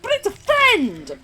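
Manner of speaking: a puppy insisting the other dog is a pal; pleading dog voice. Retold or imitated. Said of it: "And they're like".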